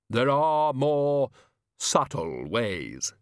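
Death hinting darkly to Susan; Death, ominous. A narrator's reading. Death speaks.

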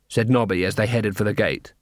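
usual speaking voice

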